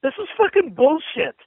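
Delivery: normal talking voice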